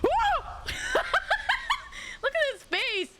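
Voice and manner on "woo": high excited whoop into laughter; real delighted whoop. Real, not acted. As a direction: cheer and crack up. Cheering at game reaction.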